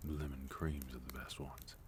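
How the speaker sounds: confiding whisper. Acted. Host whispering a sweet tip to the guest. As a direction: share the tip in a conspiratorial whisper.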